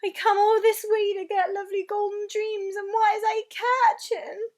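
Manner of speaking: high, loud anguished giant voice; loud, upset complaint; distressed. Acted. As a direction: rail against the bad luck. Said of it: "getting more distressed every moment".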